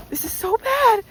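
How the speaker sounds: high, loud yelp of dismay; blurting out panic as her feet slide; real alarm. Real, not acted. Slipping on the icy hill, she blurts it out high and loud.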